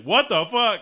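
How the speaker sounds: high, loud startled cry of alarm; startled yelp. Retold or imitated. Hawk woken by an owl, startled high yelp. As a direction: jolt awake and yelp it.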